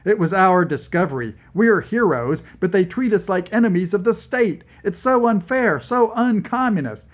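building from firm to high, loud indignation; voice rising. Acted. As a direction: let the outrage climb line by line. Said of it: "My voice rose".

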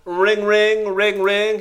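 sing-song phone ring; ringtone imitation. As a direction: chime it like a ringing phone.

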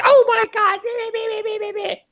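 very high squealed mock outrage; hysterical Tumblr poster. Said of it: "it would have been like".

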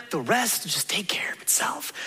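drops to a sudden whisper. Real, not acted. Pastor confiding to the congregation, whispered. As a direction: lean in and whisper it like a secret.

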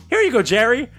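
waitress voice. Retold or imitated. Waitress quoted in story.

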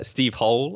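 Hall said like hole, with a long o. Shown as a demonstration. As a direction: correct Hall to rhyme with goal. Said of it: "It's, uh".

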